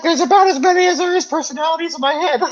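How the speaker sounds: high, laughing put-on voice; laughing mock quote. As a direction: giggle through a silly impersonation. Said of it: "you're like".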